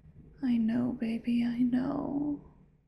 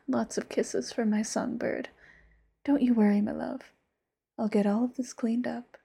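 whispered yandere lover; 10 dB louder, faster than usual. Acted. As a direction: close whisper, sweet and possessive.

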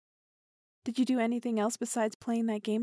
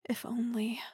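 soft longing whisper; 3 dB quieter than usual. Acted. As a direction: breathe it out dreamily. Intimate longing with partner.

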